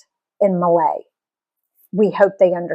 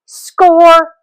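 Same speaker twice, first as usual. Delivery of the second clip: triumphant cheer; 8 semitones higher, 6 dB louder than usual. Acted. Family grabs empty seats.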